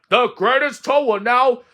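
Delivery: high, loud stage announcer; strange announcer voice. Acted. Strange voice begins introducing Toad, loud grand announcement. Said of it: "Then the voice said".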